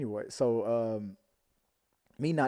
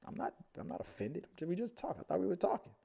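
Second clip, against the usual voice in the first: mock high voice; 8 semitones higher, 6 dB quieter than usual. Retelling what was said to him.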